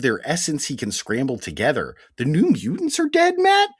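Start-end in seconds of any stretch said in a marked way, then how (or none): 2.2-3.7 high, loud incredulous question; mock disbelief. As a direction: act shocked at the news. Mock shock at the plot.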